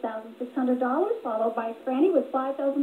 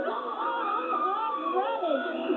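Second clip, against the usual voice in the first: drawn-out winner call; 5 semitones higher, much slower than usual. Real, not acted. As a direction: stretch the name out big and slow. Announcing the game's winner.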